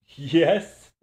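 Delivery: loud, high yes breaking into laughter; laughing yell. Acted. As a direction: shout it and crack up. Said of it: "he yelled out, beginning to laugh".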